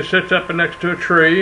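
usual speaking voice

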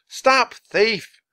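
high, loud street shout; yelling. Acted. Two boys in pursuit, yelling after the runaway. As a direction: raise the alarm, pitch well up. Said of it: "Both boys were yelling now".

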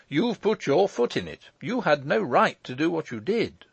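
high-pitched, scolding lawyer; crying out. A narrator's reading. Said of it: "cried the lawyer".

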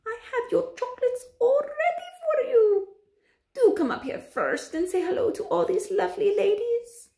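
very high, sugar-sweet witch; syrupy cooing witch. A narrator's reading. Grand High Witch welcoming a boy onstage, syrupy coo. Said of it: "soft and gentle and absolutely dripping with syrup".

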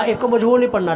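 normal talking voice

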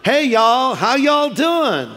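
loud, high Southern greeting with drawn-out vowels; Southern accent. Acted. Preacher mimics a greeting in the South, Southern accent. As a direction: put on a folksy Southern drawl. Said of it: "In the South".

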